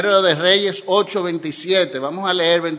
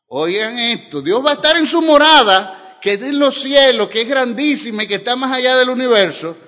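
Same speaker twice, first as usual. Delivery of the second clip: preaching; 5 semitones higher, 4 dB louder than usual. Acted. Preaching with force.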